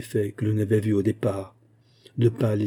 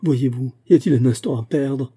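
louder, higher urgent exclamation; 4 semitones higher, 6 dB louder than usual. A narrator's reading. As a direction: exclaim urgently, no time to lose. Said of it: "s'écria-t-on". (exclamation pressante, plus forte et aiguë)

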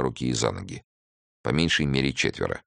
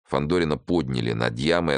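usual speaking voice

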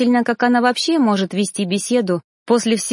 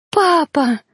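high, loud tearful cry; sobbing call; 7 semitones higher, 3 dB louder than usual. A narrator's reading. Girl sobbing after her father, loud high cry. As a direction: wail it through sobs. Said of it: "рыдая в голос".

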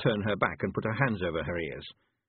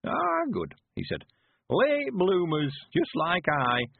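put-on high, slow creaky old countryman; old man voice; 9 semitones higher, slower than usual. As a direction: play a cheerful old villager.

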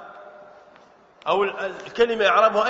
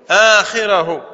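loud emphasis; 2 semitones lower, 9 dB louder, much slower than usual. Shown as a demonstration. Teacher to class, loud stressed آخره. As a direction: punch آخره out loud and falling. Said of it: "ونضم".

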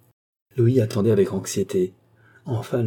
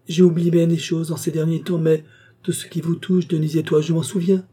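plus haut, fervent; affirmation émue; 6 semitones higher than usual. A narrator's reading. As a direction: insiste avec ferveur. Marquis insists on memory. (le marquis affirme avec ferveur)

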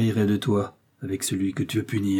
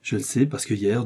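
ordinary delivery

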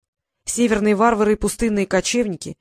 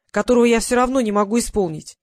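usual speaking voice

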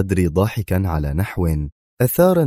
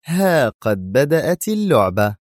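gleeful; 7 semitones higher than usual. A narrator's reading. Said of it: "تقول في جذل".

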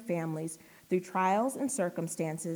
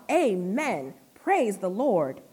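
loud, drawn-out cry of worship; crying out praise; 6 dB louder, slower than usual. Acted. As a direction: cry it out to the throne, word by word. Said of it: "They cried out".

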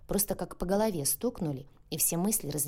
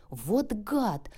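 sharp, higher outraged exclaim; 6 semitones higher, 4 dB louder, much slower than usual. A narrator's reading. Lia to her friend, a startled outraged cry. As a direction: Lia jumps up, startled and indignant. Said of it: "воскликнула Лия".

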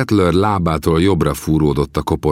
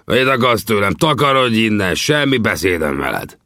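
angry snapping; 6 semitones higher than usual. A narrator's reading. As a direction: snap back furiously at him. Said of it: "dühösen rám förmett".

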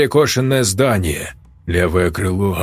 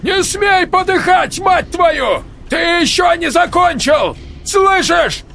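desperate yelling. A narrator's reading. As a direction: scream at him to stay alive. Desperately reviving friend, yelling. (отчаянный крик)

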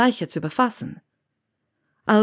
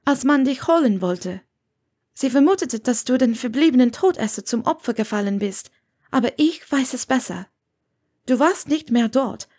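slightly raised character voice; lightly voiced earnest woman; 6 semitones higher than usual. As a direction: voice a woman carefully explaining herself. Narrator voices Hermione.

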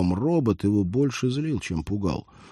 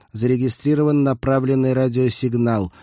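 raised, even robotic monotone; cyborg voice; 5 semitones higher, 4 dB louder than usual. A narrator's reading. Cyborg reports a radio signal, robotic monotone. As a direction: announce it like an automated alert.

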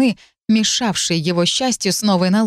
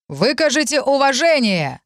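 high ringing command to the crowd; herald's shout; 7 semitones higher than usual. A narrator's reading. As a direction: call it out across the square. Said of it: "выкрикнул".

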